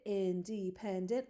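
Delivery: independent read out slowly, each syllable separate. Shown as a demonstration. Teacher reading the word with students, slow syllables. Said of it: "Let's read it".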